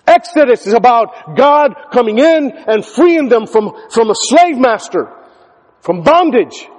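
impassioned sermon voice; full-force preaching. Acted. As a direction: drive the point home to the church.

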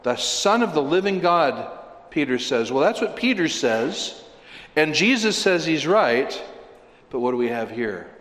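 ordinary delivery

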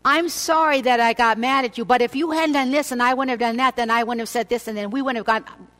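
hammed-up sorry-but-it's-your-fault complaint; defensive blaming apology. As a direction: rattle off a huffy excuse as you say sorry. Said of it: "Don't say".

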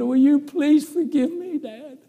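very high, drawn-out plea; pleading for forgiveness. Retold or imitated. Boy begs forgiveness.

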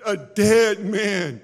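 hammering sinners with questions from the pulpit; preaching. Acted. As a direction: drive each line home to the congregation. Preaching at full force.

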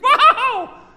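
high, loud drawn-out wow; hammed-up squeal of amazement; so excited. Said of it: "He was so excited".

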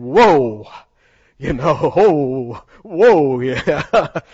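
loud, laughing amazement; awed whoa. Retold or imitated. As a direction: relive the jaw-drop at the canyon edge. Reliving Grand Canyon moment.

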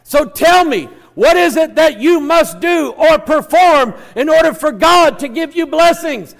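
high, loud, emphatic sermon delivery; full-force preaching. Real, not acted. Preacher telling the congregation God will bless them, impassioned preaching. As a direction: preach it out with power to the whole room.

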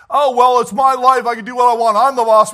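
loud, high, hammed-up defiance; mock proud congregant. Preacher mimics a prideful parent, loud and scornful. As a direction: sneer it like a stubborn know-it-all.

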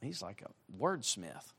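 soft, low, impressed murmur; quietly amazed remark; awed aside. Retold or imitated. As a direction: breathe it as a private wow. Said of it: "I'm like".

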